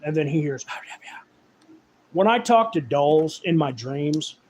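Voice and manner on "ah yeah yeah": breathy unvoiced whisper, slow. Doll whispering into Jack's ear in a movie, eerie whisper. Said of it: "hearing that whispering".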